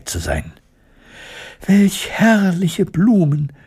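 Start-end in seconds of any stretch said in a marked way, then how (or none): 1.7-3.5 put-on high, slow, enchanted little girl's cry; delighted girl Elli. Elli admiring the poppy field, high delighted girl's voice. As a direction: marvel at the flowers like a young girl. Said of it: "rief Elli".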